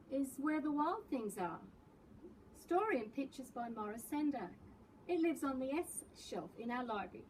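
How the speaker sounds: usual speaking voice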